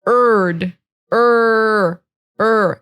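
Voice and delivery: "erd" cut down to a held "er"; sound drill. Shown as a demonstration. Teacher isolating the E R sound, drawn-out syllable. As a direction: hold the r vowel in "er". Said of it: "not red".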